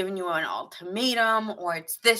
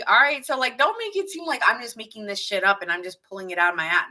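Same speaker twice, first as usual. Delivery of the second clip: ordinary delivery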